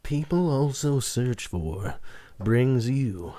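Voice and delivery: slow, measured presenter intro; mock announcer. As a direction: present it grandly, phrase by phrase. Mock show intro for the segment.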